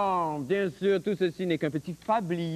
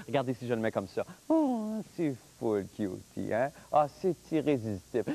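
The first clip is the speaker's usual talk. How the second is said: cutesy cooing; 9 semitones lower, 4 dB quieter than usual. Acted. Cooing with kittens on him.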